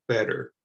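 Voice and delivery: "better" with no T, said like a D; flap T. Shown as a demonstration. Teacher drilling "better" with a flapped T. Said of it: "You don't hear the T at all".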